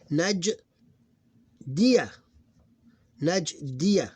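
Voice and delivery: "Najdiya" split as Naj, then di-ya, loud; different voweling. Shown as a demonstration. Teacher showing two different consonants, emphatic syllable by syllable. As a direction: lift and separate the syllables of "Najdiya". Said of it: "consonants with different voweling".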